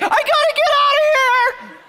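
very high, loud mock-panic squeal; hammed-up frantic falsetto; panicked sleepwalker. Sleepwalking wife at the door, imitated shrieking panic.

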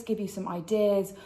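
usual speaking voice

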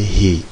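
"hi" with a front i vowel; thin letter with i. Shown as a demonstration. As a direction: sound out "hi" as a light letter with i. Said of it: "i ile".

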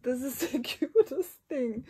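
high, loud teary laugh; laughing gush. Real, not acted. Gushing over the cute gift, laughing. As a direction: laugh it out in delight.

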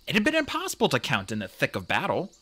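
ordinary delivery, nothing marked

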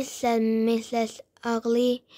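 ordinary delivery

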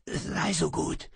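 croaked. A narrator's reading. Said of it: "krächzte ich".

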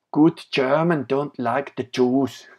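mock German accent.

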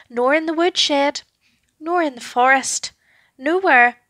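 usual speaking voice

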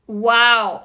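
usual speaking voice

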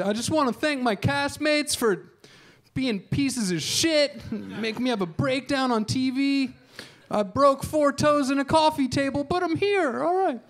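a shaky reality star thanking nobody; overwrought reality star speech. Pretend. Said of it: "how that acceptance speech goes".

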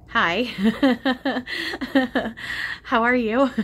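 delighted laugh breaking through a hello; bubbling laugh on a giddy hello; laughing greeting. Real, not acted. Greeting spirit, laughing warmly.

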